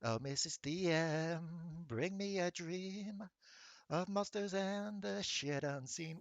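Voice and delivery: slow, soft southern drawl. Acted. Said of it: "Now I need to remember her voice".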